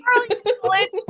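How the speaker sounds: laughing. Real, not acted. Teasing friend after singing.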